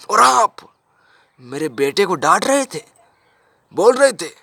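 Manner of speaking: acted outrage, loud high bursts; outraged accusation. Father accuses teacher of scolding son.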